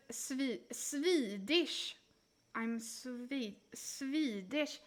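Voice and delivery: "Swedish" with a long, drawn-out I; emphasized Swedish I. Shown as a demonstration. Streamer showing the Swedish accent, "Swedish" with a stretched I. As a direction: stretch the first vowel of "Swedish". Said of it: "we emphasize the I".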